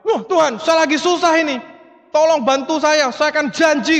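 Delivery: high, urgent mock begging; desperate pleading. Man in trouble begs God for help, acted desperate pleading. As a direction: beg God for rescue, pitched high.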